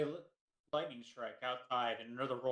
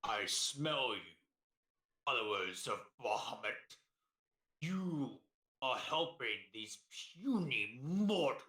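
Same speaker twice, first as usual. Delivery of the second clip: menacing dragon voice; 3 dB louder than usual. Acted. Dragon speaks to the party.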